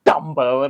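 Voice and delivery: Dumbo with the D leaned on; stress on the D. Shown as a demonstration. Insisting on the nickname, emphatic D in Dumbo. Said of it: "I will say it like that".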